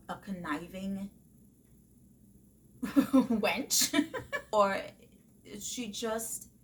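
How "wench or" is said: giggling at a cheeky word; laughing. Real, not acted. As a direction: laugh through the word, loud and amused. Mocking Rachel as conniving wench.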